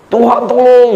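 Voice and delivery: mock yelling prayer; stretched-out mock cry for help; imitated shouted prayer. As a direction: act out a friend hollering to God. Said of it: "Saya berdoa dengan teriak".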